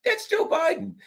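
high squeaky aside. Pretend. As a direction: keep the squeaky mock voice. Squeaky voice continues.

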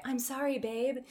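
soft, subdued character line; Tessa. Acted. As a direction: drop to a quiet apology. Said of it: "was Tessa".

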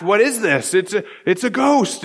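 mildly hammed-up alarm; startled reaction. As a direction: blurt it like a spooked onlooker. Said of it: "just kind of react".

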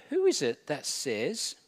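normal talking voice

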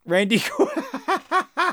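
high, drawn-out laughing squeal; helpless laughter. Real, not acted. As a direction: crack up while saying the name, dissolving into laughter. Cracking up at a casting joke.

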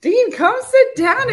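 high, loud, slow summons; calling out. Real, not acted. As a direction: call Dean over playfully. Calling her son over.

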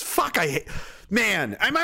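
ordinary talk, nothing marked

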